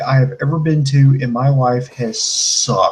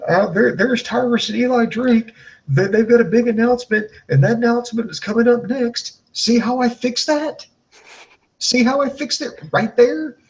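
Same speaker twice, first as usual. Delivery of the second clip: high-pitched demonstration voice; mock commentator; 8 semitones higher than usual. Host showing commentators how to tease it, high mock announcer. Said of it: "You can just go".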